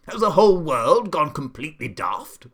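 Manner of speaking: high, loud, exasperated roar; Duke bellowing. A narrator's reading. Duke to giraffe and monkey, outraged bellow. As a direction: bellow it as if about to burst. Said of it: "he bellowed".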